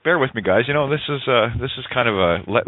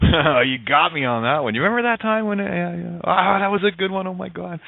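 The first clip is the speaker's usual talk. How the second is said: laugh, then higher-pitched playful recollection; amused reminiscing; 6 semitones higher than usual. Acted. Joker to an old friend about a shared prank, amused reminiscing. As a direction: relive a prank with mock delight. Acts out sharing a joke.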